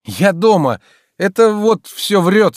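loud, high exclamation; indignant protest. A narrator's reading. Master denies the servant's lie to the maid, indignant. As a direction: protest loudly, stretching the accusation.

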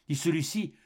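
plain reading voice

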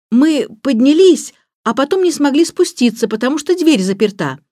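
put-on high, loud child's explanation; calling through a door. A narrator's reading. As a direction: shout your excuse so they hear. Boy shouts explanation through door. (громко объясняет через дверь)

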